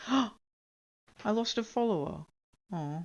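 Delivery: high, slow dismay after a gasp; gasp, then lament it slowly; sad. Real, not acted. Streamer notices a lost follower, dismayed and sad. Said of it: "Oh, I'm sad now".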